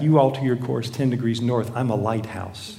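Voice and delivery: soft, low, unruffled answer; understated reply. Acted. Lighthouse answering the angry captain, quiet and understated. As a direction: deliver the punchline quietly, without any push.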